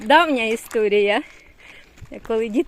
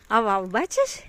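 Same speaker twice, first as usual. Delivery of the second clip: usual speaking voice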